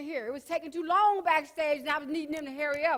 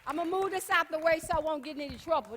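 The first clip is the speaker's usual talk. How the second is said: ordinary delivery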